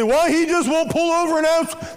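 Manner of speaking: hammed-up wife asking why he won't stop; exasperated wife. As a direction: whine it like a fed-up wife. Say, wife's complaint.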